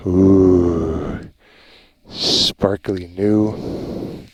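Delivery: low, very slow admiring coo; delighted coo. Real, not acted. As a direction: savour the words like a treat. Delighted by new wing.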